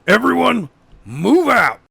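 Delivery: high, loud order to the team; rally everyone out; detective shouting into his radio; commanding shout. Acted. Daniels orders the search.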